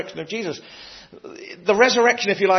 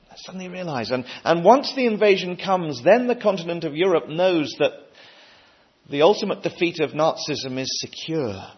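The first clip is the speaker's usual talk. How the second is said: usual speaking voice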